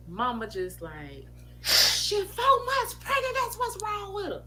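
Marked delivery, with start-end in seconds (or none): 1.6-4.4 high, loud crying outburst; crying mother. Retold or imitated. Mother telling the father the news, loud and tearful. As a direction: blurt it out through tears.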